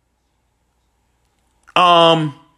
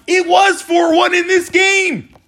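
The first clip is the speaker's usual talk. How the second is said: high, drawn-out outraged cry; exasperated yell; 10 semitones higher than usual. Real, not acted. Exasperated rant about the lost lead.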